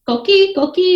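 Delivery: high chirpy frog call, twice; coqui frog call. Retold or imitated. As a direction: mimic the frog's two-note chirp. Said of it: "that's the sound that it makes".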